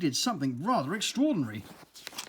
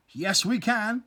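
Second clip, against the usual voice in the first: high, loud insistence; angry reply; 6 semitones higher, 5 dB louder, slower than usual. Acted. Bee angrily insisting to the earwig, high and loud. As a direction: insist it hotly. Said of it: "replied Buzzer angrily".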